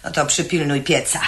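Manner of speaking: ordinary talk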